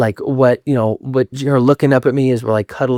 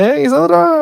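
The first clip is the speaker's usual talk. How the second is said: very high, drawn-out hello; put-on high sing-out greeting to hide tears; fake cheery greeting; slower than usual.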